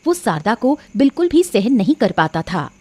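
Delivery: usual speaking voice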